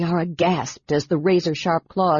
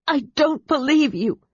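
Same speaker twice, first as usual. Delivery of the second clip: much higher, sharp angry denial; 8 semitones higher than usual. Acted. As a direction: fling the denial at him. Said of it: "She shook her head angrily".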